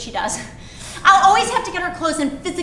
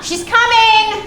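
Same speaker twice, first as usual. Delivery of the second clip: high, loud, drawn-out holler; calling out; 7 semitones higher, 5 dB louder, slower than usual. Acted. Mother telling husband the child is coming, calling out. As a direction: shout word to someone downstairs.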